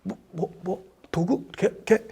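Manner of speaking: a speechless servant stammering quietly; stammering, lost for words. Acted. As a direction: trip over the words, too flustered to answer. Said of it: "말을 못한 거야".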